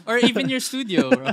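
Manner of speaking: high, loud, fast laughing words. Real, not acted. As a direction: laugh it out quickly. Host laughs at the address joke.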